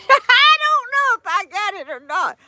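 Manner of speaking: high, laughing through the words. Real, not acted. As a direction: laugh it off bashfully. Laughing after compliment.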